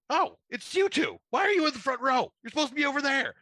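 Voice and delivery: host spotting guests in the wrong seats, high surprised call. Retold or imitated. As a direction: act caught off guard, pointing out a mistake. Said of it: "He's going".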